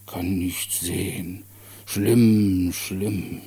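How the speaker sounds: langsam, gedehnt und betroffen; grübelnder kindlicher Mann. Acted. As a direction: staunend langsam vor sich hin sinnieren. Der Kranke spricht zum Erzähler. (langsam und ernst mitfühlend)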